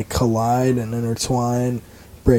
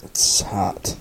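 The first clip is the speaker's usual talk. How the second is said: teasing whisper; 4 semitones lower, 4 dB quieter than usual. Acted. Teasing whisper about raspy voice.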